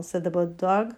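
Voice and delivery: ordinary delivery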